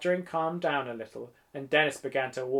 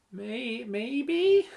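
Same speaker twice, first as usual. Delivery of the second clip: high, hesitant, rising question; falter, then squeak out a doubtful answer; tentative and high-pitched; unsure; 9 semitones higher than usual. A narrator's reading. Unsure reply to encouragement.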